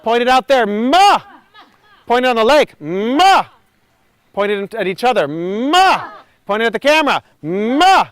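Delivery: loud, high ma aimed far away; projected calls. Shown as a demonstration. Coach calling across the lake to the group, loud. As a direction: throw the sound out to the lake. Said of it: "Point it to the trees".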